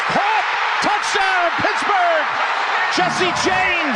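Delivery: screaming high, thrilled play-by-play; excited touchdown call. Real, not acted. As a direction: call the score at the top of your voice.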